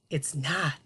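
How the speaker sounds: usual speaking voice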